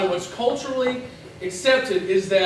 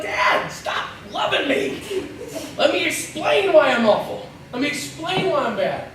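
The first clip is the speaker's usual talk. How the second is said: strained, higher-pitched comic protest; exasperated pleading; 4 semitones higher than usual. Acted. Son fending off his father's affection, exasperated pleading. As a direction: beg to be allowed to explain.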